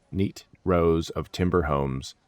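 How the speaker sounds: plain reading voice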